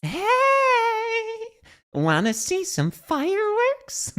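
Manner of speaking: very high, slow mock American accent; imitated American character voice. Streamer copying a game voice line, high slow mock American accent. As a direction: mimic her American line way up high, drawn out. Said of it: "That's more how we'd pronounce it".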